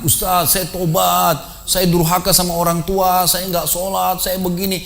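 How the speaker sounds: imitating a high, drawn-out plea; trembling repentance. Man fresh from the grave confessing to his ustaz, imitated trembling repentance. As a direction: mimic a shaken man confessing, slow and high.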